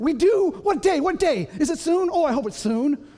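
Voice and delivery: hammed-up excitement, tongue in cheek; fake eagerness. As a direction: gush with pretend delight over a chore. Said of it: "That's all a lie".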